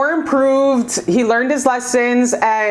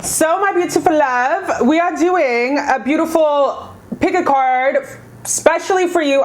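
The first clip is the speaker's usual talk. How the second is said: sweet channel welcome; 5 semitones higher than usual. Acted. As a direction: coo a welcome to your viewers. Sweet channel greeting to viewers.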